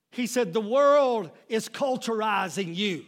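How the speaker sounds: high, loud shouted sermon climax; preaching at the top of his voice; full-force preaching. Acted. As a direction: shout the plea to the church.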